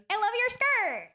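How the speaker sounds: high, gushing voice of a stranger; mimicked gushing woman. Comic imitating a gushing stranger's compliment in a high voice.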